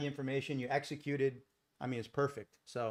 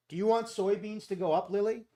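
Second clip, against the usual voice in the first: dad playfully quizzing his daughter on air; 6 semitones higher, 3 dB louder than usual. Real, not acted. Father asking his little daughter.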